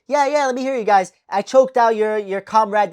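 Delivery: imitating a fighter taunting the crowd. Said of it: "he just fucking like".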